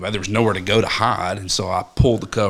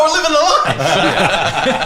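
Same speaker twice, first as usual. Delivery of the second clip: high, slow laughing brother voice; laughing brother imitation; 10 semitones higher, 3 dB louder, slower than usual. Brother answers dad, high, slow and laughing. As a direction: savor it like a carefree brother.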